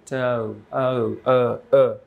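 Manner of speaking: ট then ও, each drawn out separately; isolated vowel demo. Shown as a demonstration. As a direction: repeat the vowel ও alone, stretched. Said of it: "ওই ওটা থাকছে না".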